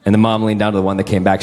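ordinary talk

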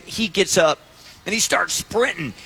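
normal talking voice

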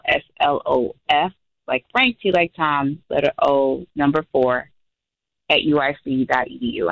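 email rattled off quickly; fast. Real, not acted. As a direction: run through the spelling without pausing. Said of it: "said that really fast".

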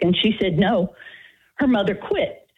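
usual speaking voice

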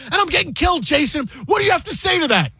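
high, shouted complaint; imitating Rappaport shouting in exasperation; mock outraged yell. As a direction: yell it like you're getting crushed. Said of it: "And he goes".

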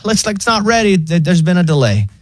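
high, casual robot voice; mock AI assistant, high and relaxed; chill AI voice. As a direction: play the breezy bot. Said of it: "it's so weirdly chill".

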